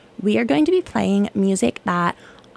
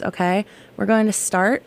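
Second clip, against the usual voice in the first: normal talking voice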